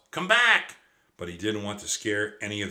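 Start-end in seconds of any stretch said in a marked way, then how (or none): 0.1-0.8 loud, high call of alarm; urgent shouted warning. Acted. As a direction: yell it after the boy across the field. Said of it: "He wanted to shout".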